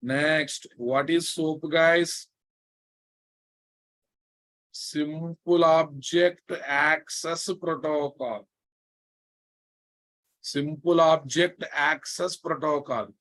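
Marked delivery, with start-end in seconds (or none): 4.7-8.5 each word spelled out slowly; slow dictation. Shown as a demonstration. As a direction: dictate it for note-taking. Teacher dictating the acronym slowly.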